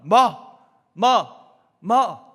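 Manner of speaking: loud barked stadium shouts; crowd shout. Retold or imitated. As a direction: bark it like a whole stand of fans. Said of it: "또 이런 외침도 있습니다". (관중들이 상대 투수에게, 크게 외치는 소리)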